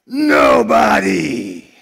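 loud, slow, stretched-out shouted answer; family shouting back. Retold or imitated. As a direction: bellow it back in unison. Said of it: "And they would reply".

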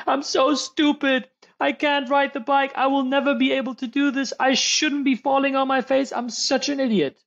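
high-pitched mock-sobbing little girl; crying child. Daughter who fell off the bike, pretend crying. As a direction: wail it like an upset child, hammed-up. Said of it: "she's crying. She says".

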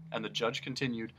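ordinary delivery, nothing marked

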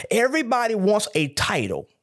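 ordinary delivery

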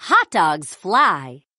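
usual speaking voice

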